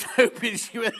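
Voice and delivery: talking through real giggles; helpless laughter. Real, not acted. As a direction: try to explain while cracking up. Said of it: "I haven't laughed like that for ages".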